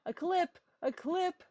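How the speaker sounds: high excited yell; chat yelling. Retold or imitated. Viewer yelling in chat for a clip. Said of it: "He's screaming at me in chat".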